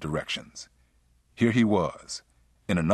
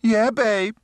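high, loud female-character voice; answering a call; 6 dB louder than usual. A narrator's reading. Sheila answers her lover from another room, calling back. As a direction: call back in a pitched-up woman's voice.

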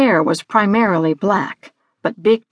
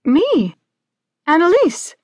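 put-on high, timid girl's voice; small nervous high-pitched reply; Annalise; 8 semitones higher than usual. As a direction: answer up high, intimidated by the creature.

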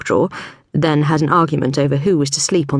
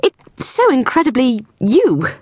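higher, slow, drawn-out praise; gushing Cordelia; 8 semitones higher than usual. A narrator's reading. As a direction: lay the flattery on thick. Cordelia voiced in narration.

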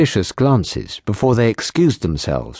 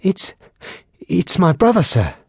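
a higher, broken, frightened stammer; stuttering; 8 semitones higher than usual. Acted. Terrified patrolman stammering about his brother, higher. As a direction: force the words out through fear. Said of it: "he barely managed to stutter out".

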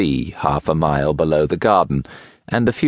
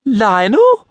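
high, loud call across the terrace; woman calling out a name in surprise; 6 dB louder than usual. A narrator's reading. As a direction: call his name, rising. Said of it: "she called".

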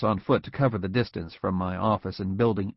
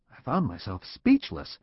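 ordinary delivery

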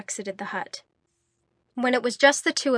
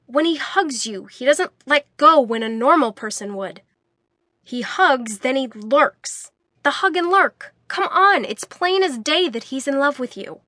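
insistent; 4 semitones higher, 6 dB louder than usual. A narrator's reading. Jens insisting, pitch raised.

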